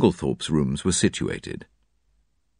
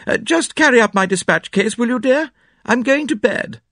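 very high, raised calling voice; calling from the hall; 4 dB louder than usual. A narrator's reading. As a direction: call an order to someone outside the room. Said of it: "called to Cynthia from the hall".

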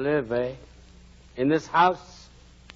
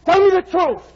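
much higher, louder frantic demand; desperate shout; 12 dB louder than usual. Acted. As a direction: shout for the truth about her. Heathcliff demands news of Kathy.

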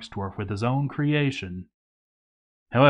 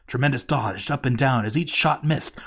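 normal talking voice

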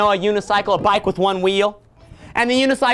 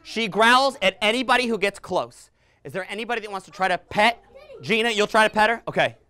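ordinary talk

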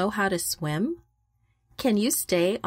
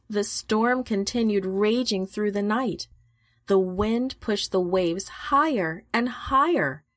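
ordinary delivery, nothing marked

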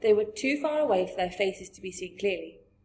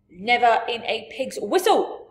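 loud, scoffing giant's refusal; emphatic cry; 3 semitones higher, 9 dB louder than usual. A narrator's reading. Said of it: "cried the B F G".

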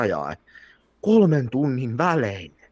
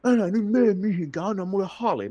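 put-on much higher pleading drunk voice; imitating drunk woman; 10 semitones higher than usual. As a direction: beg for a hug like a tipsy stranger. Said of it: "Sit se on silleen".

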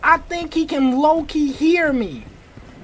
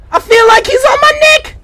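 fast, loud, very high; frantic rushed shouting; panicked yell; 11 semitones higher, 8 dB louder, faster than usual. Real, not acted. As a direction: yell it as he closes in. Pursuer close behind in game.

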